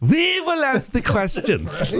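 high, slow hammed-up German officer voice; jokey German interrogator accent; mock German villain. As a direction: laugh through a mock interrogator line. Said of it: "Nazi joke".

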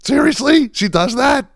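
very high, squeaky mock amazement; jaw-dropped disbelief. Porn directors watching her movie, hammed-up amazement. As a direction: imitate stunned onlookers, voice shooting way up. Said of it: "their jaws hit the ground".

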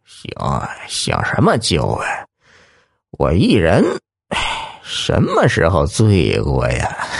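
put-on slurred drunk mumble; words mushed like a mouthful of marbles. A narrator's reading. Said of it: "含糊不清地说道".

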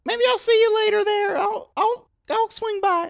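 high-pitched awkward store guy; squeaky oddball coworker voice; high character voice. Acted. Clint character continues.

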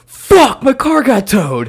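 high shouted curse over the towed car; retold shout. Friend yelling that his car got towed.

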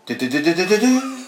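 imitated toy guitar riff, rising high; high sing-song "do-do-do" jingle. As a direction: mimic a sensor toy going off. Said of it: "And hear the".

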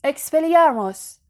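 shouted spell. A narrator's reading. Harry, furious, shouting the spell at Malfoy. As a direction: fling the disarming spell out hard. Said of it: "فریاد زد".

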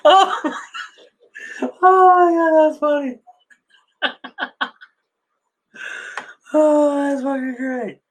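real, helpless amusement at the punchline; oh my God, that's hilarious; laughing delight. Real, not acted. Cracking up at the joke.

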